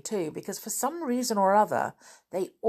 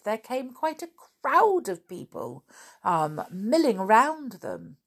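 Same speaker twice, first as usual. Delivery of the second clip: ordinary delivery, nothing marked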